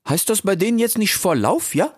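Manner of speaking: high, cheeky youth voice; Ilias. Acted. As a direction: call out playfully, very high. (frecher Ilias, hoch und laut gerufen)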